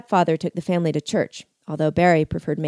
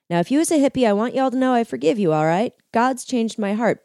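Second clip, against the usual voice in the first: preaching; 6 semitones higher than usual. Acted. Preacher fires up crowd.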